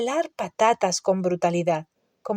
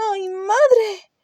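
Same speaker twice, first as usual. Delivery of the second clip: dismayed lament; 12 semitones higher than usual. A narrator's reading. Hermione after Hagrid shuts the door, dismayed wail. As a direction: cry out in distress. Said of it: "se lamentó Hermione compungida".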